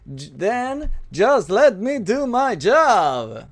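slow, drawn-out Negan swagger; slow, menacing cowboy drawl; Negan impression. Shown as a demonstration. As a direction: lean into each word like Negan. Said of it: "ese fue un homenaje a Negan".

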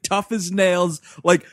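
usual speaking voice

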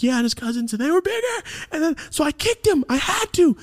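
put-on very high, wailing kid voice; imitating crying child. Crying kid explaining to mom, high mock bawling. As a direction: blubber it out in a squeaky mock wail. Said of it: "I'm crying, incoherent".

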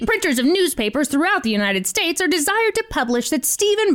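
hammed-up old newsreel announcer; old-timey voice. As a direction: read it like a 1920s radio man. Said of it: "should I do it in an old-timey voice".

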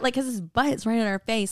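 ordinary talk, nothing marked

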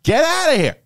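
high, loud order to get out; half-shouted shoo at the squirrel; shooing yell. Retold or imitated. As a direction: chase the squirrel out with a sharp yell. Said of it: "I just said".

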